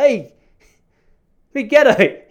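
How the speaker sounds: high, loud star-struck greeting; excited hailing. Retold or imitated. Fan greeting Mick Gatto at a boxing function, excited hailing. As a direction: call out like an over-eager fan. Hailing a notorious man at table.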